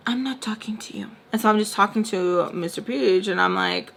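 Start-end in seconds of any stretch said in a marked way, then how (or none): 0.1-1.1 whispered, dismissive retort; whispered aside. Acted. Brushing off someone who chimed in, whispered aside.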